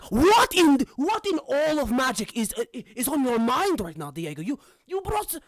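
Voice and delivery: high, loud sputtering disbelief; outraged outburst. Acted. Mentor scolds Diego for bringing his brother, outraged outburst. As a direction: blow up after holding it in, stammering. GM voices Alejandro losing his cool.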